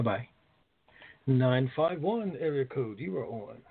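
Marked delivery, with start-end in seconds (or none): none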